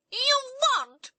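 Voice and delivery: high, loud screamed denial. A narrator's reading. Princess screams at her sister over the throne, high loud. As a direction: screech it at your sister. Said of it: "screamed Cobalt".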